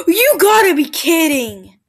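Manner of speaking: loud, slow cry of disbelief; frustrated cry. Real, not acted. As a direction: wail it at the screen. Frustrated at the game.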